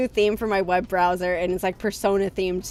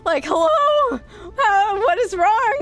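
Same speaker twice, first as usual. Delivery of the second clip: higher, louder disbelieving rant; incredulous rant; 9 semitones higher, 5 dB louder than usual. Retold or imitated. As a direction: protest in loud, high disbelief. Incredulous rant about own voice.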